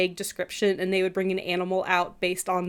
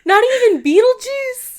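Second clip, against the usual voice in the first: high, loud disbelief; can't believe it; shocked; 14 semitones higher, 9 dB louder than usual. Real, not acted. Shocked at sister.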